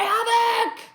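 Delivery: shouted high and loud; battle cry. Acted. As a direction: scream havoc like a war cry.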